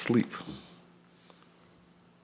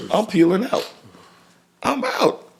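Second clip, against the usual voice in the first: much higher, quick getaway lines; a burst of giddy urgency; excited escape. Retold or imitated. He describes rushing out of the house, voice jumping high with urgency.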